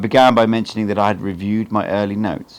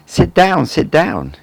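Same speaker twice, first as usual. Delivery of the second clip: hearty, cheerful welcome, a bit higher and louder; jovial; 9 semitones higher, 7 dB louder, much slower than usual. A narrator's reading. Old teacher welcoming his apprentice, jovial and hearty. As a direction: greet him like an old friend, beaming. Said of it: "in a jovial tone".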